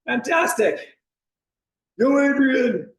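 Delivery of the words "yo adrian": a light Rocky Balboa call, higher; Rocky impression. Coach joking to class as Rocky, lightly voiced. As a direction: toss off a quick Rocky line. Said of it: "a bunch of little Rockies".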